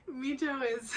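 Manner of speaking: real laugh breaking up the words. Real, not acted. Fan laughing at the couple, laughing hard. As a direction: laugh through it, can't stop.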